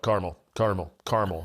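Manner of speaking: caramel as "CAR-mul", repeated; two-syllable caramel. Retold or imitated. Cousins each answering him, caramel as CAR-mul. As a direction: list caramel three times as CAR-mul. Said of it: "and they were all like".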